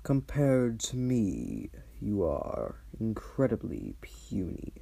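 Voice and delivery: looming archdevil, quietly dismissive; dragon voice. Acted.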